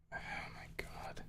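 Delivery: slow breathy whisper. Real, not acted. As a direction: whisper it slowly in dismay. Whispered dismay.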